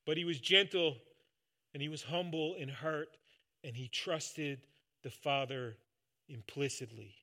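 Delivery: normal talking voice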